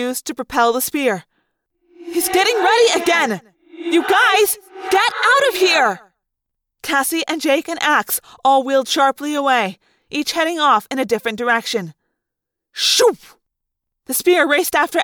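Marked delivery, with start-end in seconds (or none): 2.1-6.1 high, fast alarm; urgent warning shout. Acted. Rachel warning friends of the next spear, high alarm. 12.7-13.2 sharp whooshing spear sound; quick spat whoosh; shoop. A narrator's reading. Narrator voicing the spear launch with a whoosh.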